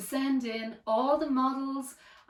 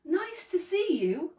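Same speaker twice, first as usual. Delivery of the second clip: higher, lightly hooted greeting; friendly hoot; 7 semitones higher than usual. A narrator's reading. Duckbills greet Drip at the river, friendly hooting. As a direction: call it out as a welcoming duckbill. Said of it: "and they hooted".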